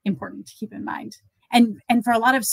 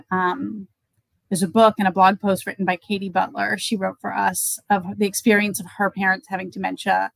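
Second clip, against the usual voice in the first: usual speaking voice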